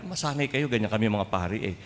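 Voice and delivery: ordinary talk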